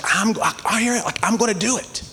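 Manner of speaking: high-pitched, halting determination; worked-up resolve. Retold or imitated. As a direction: psych yourself up, pitch jumping high. Acts out her resolving to obey.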